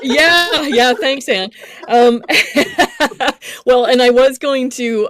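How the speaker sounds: high, loud, laughing through the words. Real, not acted. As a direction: laugh away the emotion, bright and loud. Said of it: "You okay?".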